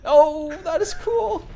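high, loud squeal of delight; excited. Real, not acted. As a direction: gush over the cool effect. Excited reaction to effect.

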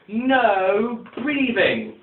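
slow, drawn-out stern command from a strict teacher. Retold or imitated. Strict teacher to the class, slow stern drawn-out command. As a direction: stretch every word out like a teacher who forbids breathing. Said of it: "So, once more, from the beginning".

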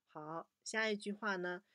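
usual speaking voice

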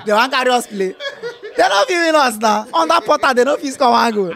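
excited gloating. Real, not acted. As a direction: crow over the rival, laughing. Gloating over beating Liverpool.

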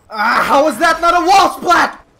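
high, loud disbelief; outraged protest. Real, not acted. Gamer robbed of a wall splat, high outraged shout. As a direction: shout it at the unfair game.

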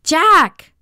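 loud, high call; sharp rise in pitch and volume; yelled name. A narrator's reading. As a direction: shout for a friend lost in the dark. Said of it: "I yelled".

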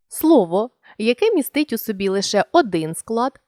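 normal talking voice

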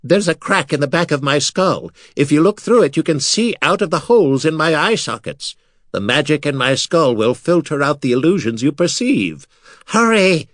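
high skull voice, urgent; talking skull. Acted. Skull Mira instructing Artek, high and urgent. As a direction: voice the skull, ending in a high cry.

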